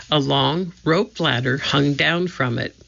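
plain reading voice

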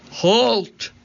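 high barked pirate halt; pirate shout. A narrator's reading. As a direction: bark it like a pirate captain. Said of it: "Cap'n Bones shouted".

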